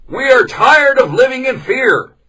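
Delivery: high, loud villager's shout; a villager yelling at the meeting; angry shout. Acted. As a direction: shout it out, fed up with fear. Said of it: "shouted one man".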